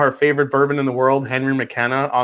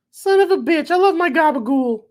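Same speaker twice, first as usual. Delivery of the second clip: high-pitched caricature mother; mock mom voice; 13 semitones higher than usual. Mom declaring her love of gabagool, high comic impression. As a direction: put on the exaggerated high mom voice.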